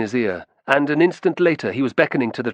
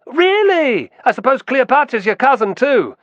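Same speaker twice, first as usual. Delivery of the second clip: much higher, louder mocking jeer; derisive jeer; 13 semitones higher, 5 dB louder than usual. A narrator's reading. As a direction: jeer at him scornfully and loud. Guard mocking Romulus.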